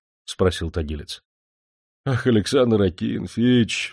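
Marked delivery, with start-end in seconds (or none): 2.0-3.9 slow, yearning exclamation; heartfelt cry. A narrator's reading. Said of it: "вскричал от всего сердца Орёлка".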